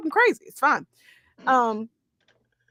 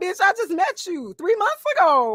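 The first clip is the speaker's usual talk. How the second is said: mock retort; 7 semitones higher than usual. Retorting to angry girl.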